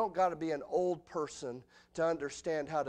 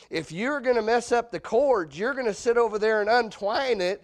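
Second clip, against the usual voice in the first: louder, higher mock stern father; stern dad; 5 semitones higher, 7 dB louder than usual. Father scolding child over the phone cord, mock stern. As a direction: lay down the house rule. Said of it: "my dad says".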